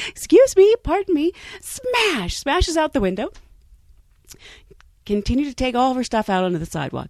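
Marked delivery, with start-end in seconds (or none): 0.1-2.4 high, prim and polite, excusing herself; overly polite. Retold or imitated. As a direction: mimic a woman who is far too polite while wrecking things. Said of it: "So she".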